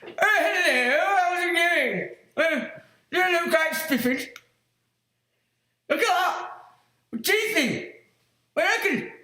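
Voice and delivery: high mock greeting, testing his false teeth; put-on voice with new teeth. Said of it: "I have to talk like this".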